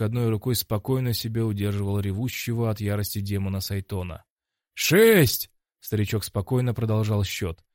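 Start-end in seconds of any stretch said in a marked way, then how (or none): 4.7-5.5 loud, high-pitched number; referee's shouted count. Acted. Referee counts over the rising fighter, loud high shout. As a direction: call the count out forcefully and high.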